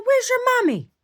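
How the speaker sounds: sweet high coaxing voice; baby talk to lost dog. Retold or imitated. Driver asking a lost little dog, high baby talk. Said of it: "I said".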